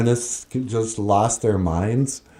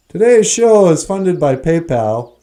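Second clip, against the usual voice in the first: ad read; 4 semitones higher, 5 dB louder than usual. Pretend. Mock sponsor announcement.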